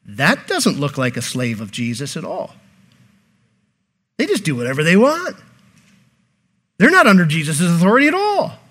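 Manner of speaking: lightly mock a judging bystander; onlookers' disapproval. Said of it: "when people see them, they go".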